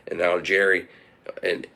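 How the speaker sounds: usual speaking voice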